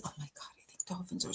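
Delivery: breathless excited whisper about the dolphins. Real, not acted. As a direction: drop to a sudden gushing whisper. Gushing aside about dolphins.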